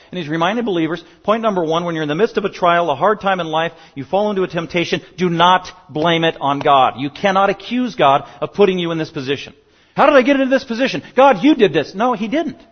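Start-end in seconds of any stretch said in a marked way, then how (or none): 1.2-9.5 fervent preaching. Acted. Preaching at full force. 9.9-11.9 high, loud exasperated complaint; frustrated believer. Acted. Preacher voices frustrated believer.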